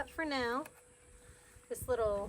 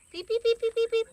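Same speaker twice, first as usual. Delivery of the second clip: very high, loud chick peeps; peeping baby chick; 12 semitones higher, 6 dB louder than usual. Acted. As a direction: peep like a tiny chick, high up.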